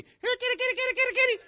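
very high, loud sing-song kitty call; high cat call. Retold or imitated. Owner calling her cat in the storm, high and loud.

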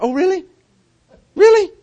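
high, sarcastic "Really?"; mocking "really". Preacher voicing God, a sarcastic high "Really?". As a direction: scoff at the devil, pitch jumping up. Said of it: "He's like".

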